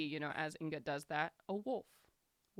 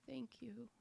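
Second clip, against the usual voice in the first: put-on a small boy's thank you, higher and much softer; shy little boy; 6 semitones higher, 8 dB quieter than usual. The rescued boy thanking the party before leaving, timid and soft. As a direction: thank them in a child's timid voice. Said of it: "and says".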